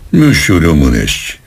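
ordinary delivery, nothing marked

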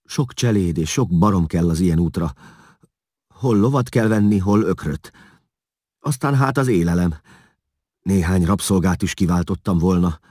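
anxious, pressured self-justifying; stammering. A narrator's reading. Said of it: "hebegte Vigilász".

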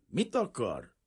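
a wary man asks with a touch of coldness; mildly unfriendly challenge; not exactly friendly. Acted. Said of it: "kérdezte nem éppen barátságosan".

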